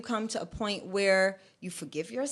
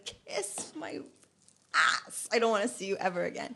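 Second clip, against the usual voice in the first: sassy brush-off. Retold or imitated. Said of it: "I wanted to say to twenty sixteen".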